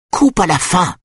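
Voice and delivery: high, angry roared command; rugissement impatient. A narrator's reading. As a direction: roar the order, pitch leaping up. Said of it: "rugit Lydia". (ordre rugi, aigu et furieux)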